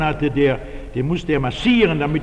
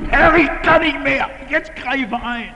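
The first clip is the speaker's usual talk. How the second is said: cry of despair to God; 9 semitones higher than usual. Retold or imitated. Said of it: "leiblich gebrüllt".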